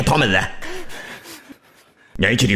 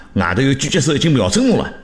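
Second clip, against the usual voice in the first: ordinary talk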